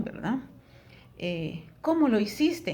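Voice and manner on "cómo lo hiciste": high, lively question rising on "cómo"; questioning intonation. Shown as a demonstration. Teacher shows students how to read a question aloud, with questioning intonation. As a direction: ask it with real intonation, "cómo" lifted high. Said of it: "dónde hay una pregunta".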